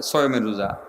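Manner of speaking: "সয়মের" said low with an m in place of the l; "সয়মের" for শাওয়ালের, as lay people say it; common mispronunciation. Shown as a demonstration. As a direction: quote the folk form "সয়মের", low and offhand. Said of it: "আপনারা কি বলেন রোজাগুলো রে".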